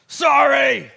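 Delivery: a loud, seething, grudging "Sorry"; angry forced sorry. Retold or imitated. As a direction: spit out the apology like an angry five-year-old. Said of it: "he looks up and he goes".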